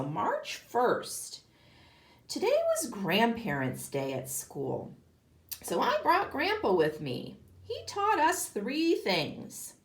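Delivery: lively read-aloud for a class; reading to children. A narrator's reading. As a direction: read it like a picture book to kids. Reading the diary entries aloud.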